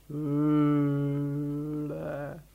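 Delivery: sehr lang gezogenes, monotones Brummen; tiefes Elefantenbrummen. A narrator's reading. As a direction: das Wort tief und endlos brummen. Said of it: "der blaue Elefant brummte auch". (brummender Elefant)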